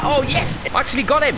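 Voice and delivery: high excited victory shout; triumphant cheer. Real, not acted. Gamer cheering as Bigfoot flees, high and excited. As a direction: celebrate the hit.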